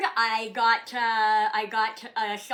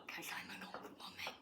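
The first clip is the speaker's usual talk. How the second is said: whisper; 17 dB quieter than usual. Real, not acted. Self-deprecating aside.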